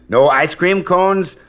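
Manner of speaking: normal talking voice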